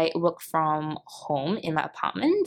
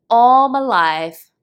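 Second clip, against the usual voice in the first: loud, drawn-out, higher overstatement; exaggerated emphasis; 5 semitones higher, 9 dB louder, much slower than usual. Real, not acted. Podcaster owning up to reliance on to-do lists, exaggerating. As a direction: stretch it out loudly for dramatic effect. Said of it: "I'm pretty much exaggerating right here".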